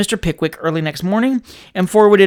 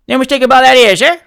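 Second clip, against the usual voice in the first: louder cockney servant calling through a door; Sam through the keyhole; 6 semitones higher, 7 dB louder than usual. A narrator's reading. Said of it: "replied another voice through the keyhole".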